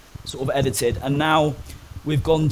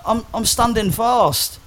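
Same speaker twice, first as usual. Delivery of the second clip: higher, slow, put-on innocent voice; naive younger self; 8 semitones higher, slower than usual. Cleaner explaining himself to the officer, high slow innocent voice. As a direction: play it as a clueless new prisoner. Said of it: "And I was like".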